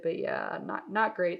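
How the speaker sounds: usual speaking voice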